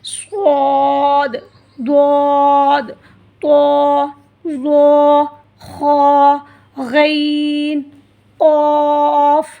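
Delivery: each letter drawn out slow, thick and heavy. Shown as a demonstration. Teacher shows students the heavy letters slowly. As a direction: say स्वाद, द्वाद, क़ाफ़ slow and full-mouthed. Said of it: "इनको मोटा पढ़ा जाता है".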